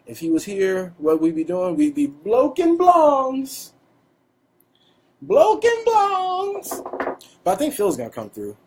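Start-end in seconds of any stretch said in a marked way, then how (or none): 1.7-3.7 slow, high, strung-out drawl; drawn-out stoner voice. Pretend. 5.2-6.8 very slow, very high sing-song drawl; exaggerated stoner drawl. Repeated, even more drawn.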